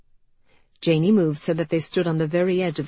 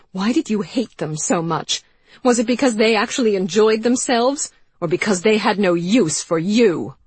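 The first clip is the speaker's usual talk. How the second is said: loud, higher, taunting; venomous taunt; 5 semitones higher, 6 dB louder than usual. Acted. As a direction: needle her with contempt. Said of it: "The venom in Anne's voice".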